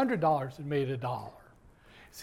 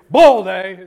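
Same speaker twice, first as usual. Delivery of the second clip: bull hit loud and heavy; emphatic bull; 15 dB louder than usual. Shown as a demonstration. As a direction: stamp down on bull. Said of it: "We need emphasis".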